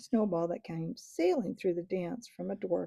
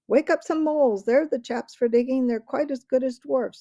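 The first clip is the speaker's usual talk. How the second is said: louder, higher excited creature voice; eager crowd call; 5 semitones higher, 8 dB louder than usual. A narrator's reading. A woodland creature calling out to the crowd, excited and loud. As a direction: call out a bright idea.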